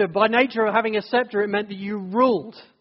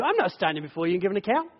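rapid defiant brush-off; 3 dB quieter, much faster than usual. Retold or imitated. Voicing someone defying God.

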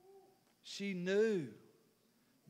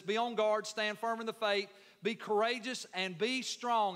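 raised, slowed pulpit reading; loud, higher scripture read from the pulpit; preaching scripture aloud; 5 semitones higher, 5 dB louder than usual. Acted. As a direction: proclaim the verse to the room.

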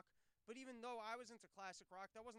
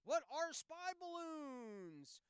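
much higher, louder, very slow ditzy question; airhead celebrity voice; 9 semitones higher, 9 dB louder, much slower than usual. Pretend. Host mocking celebrities in a slow high ditzy voice. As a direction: ask it like a clueless starlet. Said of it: "if I talk like this all the time".

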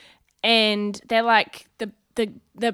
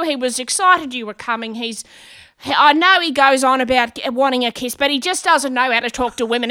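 apologetic spiel; 3 semitones higher, 7 dB louder than usual. Retold or imitated. Mum apologising for her son.

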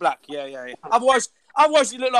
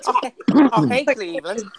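lightly voiced goofy American cartoon dad; rough cartoon American impression; Peter Griffin. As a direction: have a go at a cartoon American accent. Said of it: "an impression of Peter Griffin".

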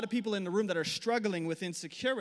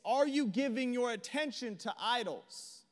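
usual speaking voice

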